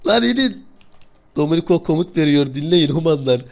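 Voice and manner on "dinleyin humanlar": normal talking voice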